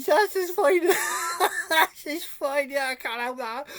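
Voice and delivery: high voice breaking into real giggles; squeaky, laughter-filled words; helpless laughter. Real, not acted. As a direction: try to talk while cracking up. Cracking up as missus laughs.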